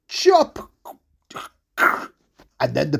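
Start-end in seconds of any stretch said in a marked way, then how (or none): 0.1-0.7 high, explosive axe-blow shout; chopping cry. Acted. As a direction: punch it out like a swung axe. Acting out the axe blows.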